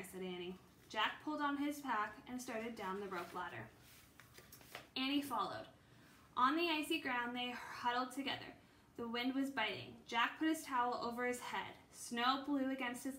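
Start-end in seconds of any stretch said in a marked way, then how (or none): none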